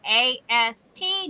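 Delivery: A S T spoken as separate letters, slowly; clear, slow spelling of A S T; spelled letter by letter. Shown as a demonstration. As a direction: spell out A S T letter by letter, not as a word. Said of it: "Michelle asked".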